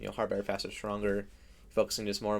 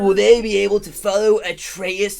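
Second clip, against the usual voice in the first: louder, higher, drawn-out mock narration; mock movie-trailer narrator; 8 semitones higher, 9 dB louder, slower than usual. Friends parody an eighties coming of age movie, hammed-up narration. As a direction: play a hammed-up trailer voice-over.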